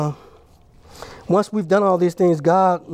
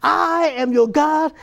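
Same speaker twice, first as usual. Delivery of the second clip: higher, declaiming preacher's God; 6 semitones higher than usual. Acted. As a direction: proclaim it as God calling his people. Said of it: "God is saying".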